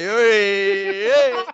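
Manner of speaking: loud high drawn-out eeeh; Marisa Laurito cry. Retold or imitated. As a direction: belt out a showy eeeh. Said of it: "la mossa di Marisa Laurito". (urlo alla Marisa Laurito)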